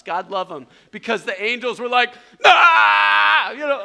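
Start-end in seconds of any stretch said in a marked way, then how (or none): 2.5-3.5 long, high, very loud cry; drawn-out comic yell of alarm; straining yell. Retold or imitated. Angels frantically keeping the car upright, straining yell.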